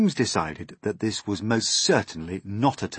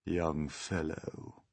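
soft, drawn-out withering voice; deep slow scorn; 2 semitones lower, 8 dB quieter, much slower than usual. A narrator's reading. Old grasshopper rebuking centipede, deep slow scorn. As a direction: say it slowly, low and scornful. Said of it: "speaking in a deep, slow, scornful voice".

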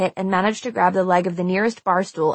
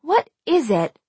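musing, higher-pitched rhetorical question; 7 semitones higher than usual. A narrator's reading. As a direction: ask it airily, expecting no answer. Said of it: "Marcy asked rhetorically".

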